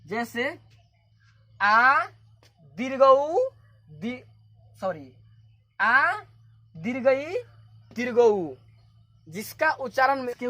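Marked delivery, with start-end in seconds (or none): 1.6-3.5 आ and दीर्घऊ loud and held, rising at the ends; emphatic long आ, दीर्घऊ; long vowel demo. Shown as a demonstration. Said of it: "जिसका उच्चारण में दुगुना स्वर लगता है". 5.8-8.5 आ, दीर्घई, दीर्घऊ with emphatic long vowels; teacher listing आ, दीर्घई, दीर्घऊ, vowels held; long vowel demo. Shown as a demonstration. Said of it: "जिसका उच्चारण में दुगुना स्वर लगता है".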